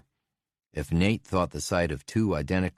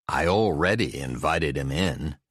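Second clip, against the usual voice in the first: grumbling. A narrator's reading. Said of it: "Joe grumbled".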